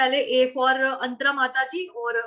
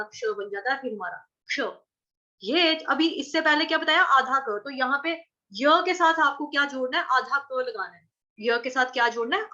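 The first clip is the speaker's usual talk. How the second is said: normal talking voice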